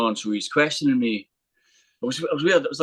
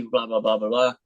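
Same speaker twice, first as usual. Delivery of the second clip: normal talking voice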